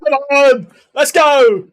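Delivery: high, loud roar into the chorus; celebratory shout. Real, not acted. Streamer cueing Sweet Caroline, celebratory shout. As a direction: yell it to fire up the crowd.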